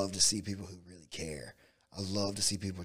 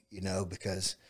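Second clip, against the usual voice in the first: ordinary talk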